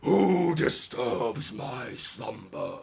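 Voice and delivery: slow, rumbling guardian voice; dusty mummy rasp. Acted. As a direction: speak slowly like an ancient sand guardian.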